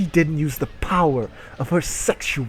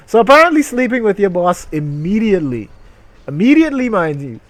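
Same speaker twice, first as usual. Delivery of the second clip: higher and louder, mocking disbelief; sarcastic scoff; 7 semitones higher, 8 dB louder, faster than usual. Real, not acted. Streamer mocking the story's idea about the boss, loud sarcastic scoff. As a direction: ridicule the story's logic.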